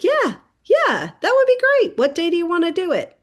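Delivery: high, bright mock-eager agreement; eager agent.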